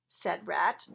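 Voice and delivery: plain reading voice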